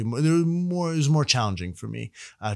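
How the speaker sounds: ordinary talk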